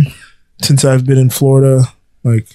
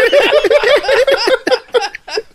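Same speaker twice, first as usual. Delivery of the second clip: long high-pitched laughing fit; helpless laughter. Real, not acted. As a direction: crack up completely. Cracking up at botched accent.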